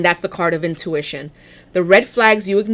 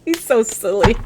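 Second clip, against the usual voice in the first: normal talking voice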